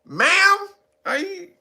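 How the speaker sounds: high, questioning hesitation; mock doubtful. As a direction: pitch it up as a puzzled question. Acts doubtful reply to ma'am.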